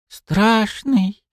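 high frightened squeak; squeaky. Acted. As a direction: squeak it out timidly. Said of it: "пискнула девушка".

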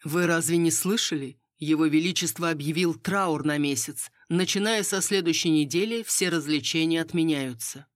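usual speaking voice

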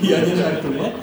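said through real laughter, loud; laughing and insisting it's true; I'm not joking, laughing out loud. Real, not acted. Laughing at own comparison.

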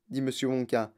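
plain reading voice

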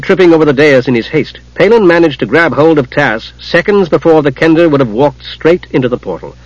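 ordinary delivery

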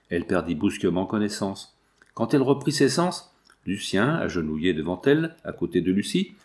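usual speaking voice

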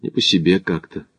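low, soft, understated unease; uneasy admission. A narrator's reading. Smith admits to his companion he feels uneasy, in a low voice. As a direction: confess it quietly, holding back. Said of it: "признался он минуту спустя".

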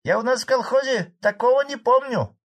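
very high put-on female voice; woman's voice. A narrator's reading. Woman heckles Fadey from back rows, high-pitched. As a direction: challenge him in a high villager's voice. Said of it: "раздался очередной женский голос с задних рядов".

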